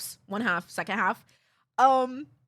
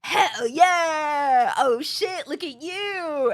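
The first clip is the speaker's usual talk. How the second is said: hyped cheer; 3 semitones higher, 4 dB louder, much slower than usual. Retold or imitated. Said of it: "I was hit with excitement".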